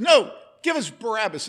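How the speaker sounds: loud high crowd demand; crowd yelling. Retold or imitated. The mob yelling at Pilate, a loud high shout. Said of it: "And they started yelling".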